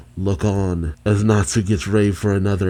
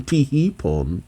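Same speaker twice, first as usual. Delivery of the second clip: higher, slow, softly cute tee-hee; giggly tee-hee; 5 semitones higher, 4 dB quieter, slower than usual. Acted. Irizawa taps her head playfully, cute and coy. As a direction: play it coy and cutesy, drawn out. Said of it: "just taps her head, going".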